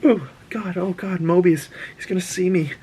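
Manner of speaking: nervous friend. Retold or imitated. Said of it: "you're not gonna just".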